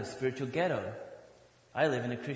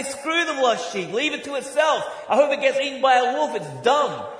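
high and loud, hammed-up heartless shepherd; callous mock rant; 9 semitones higher, 6 dB louder than usual. Pastor playing the heartless shepherd, loud mock scorn. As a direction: spit it out like a cold pragmatist. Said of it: "I say".

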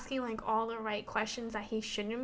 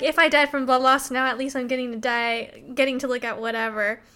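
louder, hammed-up cheeky retort; mock bold quip; 10 dB louder than usual. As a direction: toss it off brazenly, louder. Retold cheeky heroine line.

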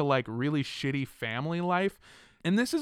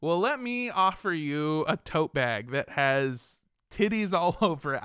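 usual speaking voice

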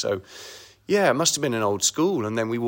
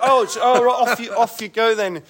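high, louder, caught-off-guard brush-off; flustered surprise; 11 semitones higher, 5 dB louder than usual. Retold or imitated. Fan left alone as the star heads to the toilet, high flustered surprise. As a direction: squeak it out, thrown and embarrassed. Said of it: "I went".

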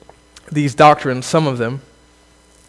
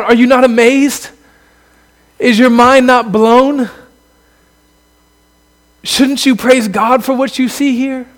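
crying out; 6 semitones higher than usual. Real, not acted. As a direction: call it out like an arena challenge, pressing each question. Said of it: "I just want to cry out".

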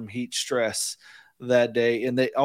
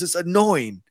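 retold irritation, a little raised; annoyed professor; 5 semitones higher, 3 dB louder, slower than usual. Russian professor complaining to his student, mildly annoyed. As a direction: imitate a fed-up teacher. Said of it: "he would get really annoyed with me".

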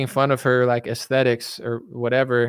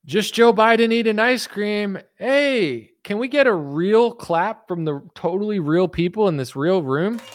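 high, mock Biden voice; squeaky doddering old president; hammed-up Biden; Biden impression; 7 semitones higher than usual.

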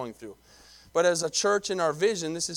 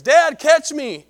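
put-on high, loud, drawn-out child's shout; excited yell; 11 semitones higher, 13 dB louder, much slower than usual. Son leaping off a rock calling to his father, loud yell. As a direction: call out to dad in mid-jump.

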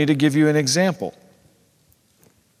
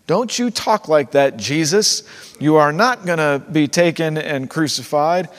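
louder, firm acted scolding; stern talking-to; 5 dB louder than usual. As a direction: rebuke him sternly, playing Peter. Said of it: "gives him a stern talking to".

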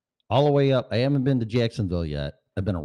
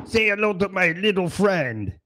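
gangster impression; 12 semitones higher than usual. Movie-gangster quote.

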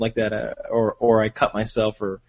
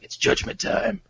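Max Payne line; 8 semitones higher, 5 dB quieter than usual. Retold or imitated. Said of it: "hold the gun up, and say".

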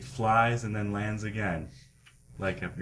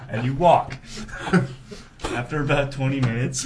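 loud, high, breaking into laughter; laughing narration; 6 semitones higher, 8 dB louder than usual. Real, not acted. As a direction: narrate through real laughter. Game master narrates while cracking up.

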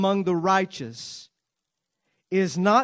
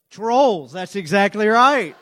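loud playful answer; 8 dB louder, slower than usual. Real, not acted. Audience answer, preacher confirms.

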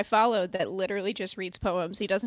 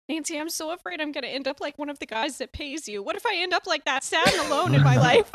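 worried roommate; 8 semitones higher, 6 dB louder than usual. Retold or imitated. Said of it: "he was like".

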